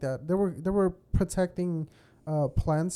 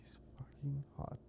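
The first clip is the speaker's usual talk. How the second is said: unvoiced whisper, secretive; 3 semitones lower, 19 dB quieter than usual. Real, not acted. Friend whispering that PewDiePie's wife is hot. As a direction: hush it like a naughty secret. Hushed remark on a woman.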